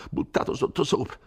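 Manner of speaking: put-on high, anxious old curate scolding; an old man begging him to flee, high and fearful; fretful old priest. Frightened curate urging Renzo to leave the village, pleading high and anxious.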